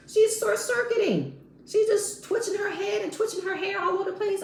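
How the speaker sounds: high, animated and exasperated; high-pitched incredulity. Real, not acted. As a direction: voice shoots way up high in disbelief. Mock exasperation at castmate spazzing.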